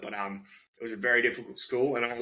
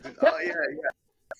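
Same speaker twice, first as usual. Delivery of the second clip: much higher, slow drawn-out realisation; a girl realising her mistake, stretched out; teacher imitating the student catching on, much higher and slow; sheepish student; much slower than usual.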